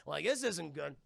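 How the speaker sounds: normal talking voice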